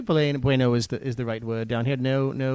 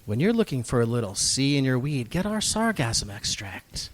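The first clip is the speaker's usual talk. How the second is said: mock commercial; 5 semitones lower than usual. Host recording a fake weed sponsor spot, hushed commercial voice. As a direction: purr it like a spoof late-night ad. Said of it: "Shall we record another commercial?".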